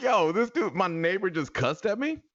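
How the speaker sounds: high, loud incredulous outrage; worked-up disbelief, half amused; retold hot indignation. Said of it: "I was hot".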